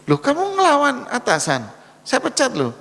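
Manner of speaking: high, sharp outraged boss; a boss shrieking a threat of dismissal; indignant boss. Acted. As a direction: scold the subordinate who dares refuse. Angry boss threatens to fire.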